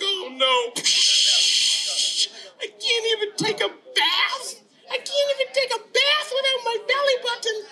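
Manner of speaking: put-on very high, wailing child voice; squeaky little boy in dismay; upset little boy. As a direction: whine it high like a distraught kid. Tommy upset in the story.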